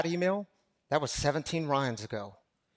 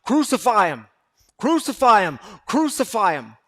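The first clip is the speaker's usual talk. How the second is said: loud retold mob chant, repeated; crowd shouting; 4 semitones higher, 9 dB louder than usual. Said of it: "The crowds were shouting out".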